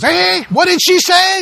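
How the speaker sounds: very high, loud, slow husband voice; hard-of-hearing shout. Acted. Husband with dead hearing aid asking his wife, shouting. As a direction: holler it like someone who can't hear.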